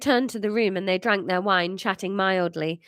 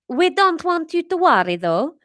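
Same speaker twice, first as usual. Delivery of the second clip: put-on higher French-accented mother; 8 semitones higher, 6 dB louder than usual. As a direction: reassure the boys in a high French accent. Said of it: "That was okay, that sentence".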